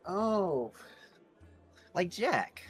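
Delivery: ordinary talk, nothing marked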